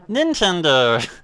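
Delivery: slow, stretched Nintendo! in a mock announcer voice; lazy booming Nintendo!; drawn-out announcer call. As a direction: drag Nintendo! out like a game announcer. Repeats the startup call.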